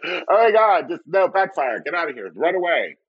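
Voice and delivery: high-pitched mock alarm; mock panic. Prankster's fake accent backfires, mock panic. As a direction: play a comic retreat in a panic.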